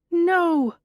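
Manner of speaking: high, loud scream of "No!"; woman screaming in a night terror. A narrator's reading. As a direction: shriek it out in terror. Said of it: "a shout in the darkness screams".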